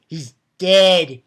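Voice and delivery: loud declaration. Real, not acted. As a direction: announce it triumphantly. Gloating over villain's death.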